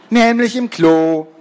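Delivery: put-on loud, high boy's interjection; calling out. A narrator's reading. Robert butts into classmates' tale, calling out. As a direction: pipe up loudly. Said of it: "rief Robert".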